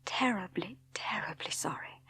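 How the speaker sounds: soft, breathy whisper of an apology; whispered, sorry and shaken. A narrator's reading. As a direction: barely breathe the words. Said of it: "I whispered".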